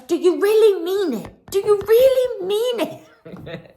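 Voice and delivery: high, loud hammed-up mock challenge; exaggerated emphatic. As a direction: overplay it emphatically, drawing out the repeat through laughter. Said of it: "like, emphatically".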